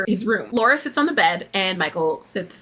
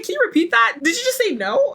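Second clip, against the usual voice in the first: higher-pitched incredulous questioning; mock shock; 8 semitones higher than usual. Host voicing the shocked woman on the phone, mock disbelief. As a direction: imitate someone taken aback by a refusal. Acts out Laura's shocked reply.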